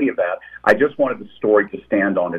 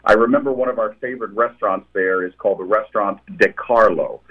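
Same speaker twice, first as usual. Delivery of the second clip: ordinary talk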